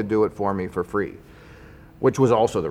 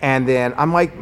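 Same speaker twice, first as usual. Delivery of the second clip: ordinary delivery, nothing marked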